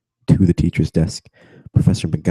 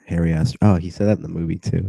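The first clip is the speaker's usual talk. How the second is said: ordinary delivery, nothing marked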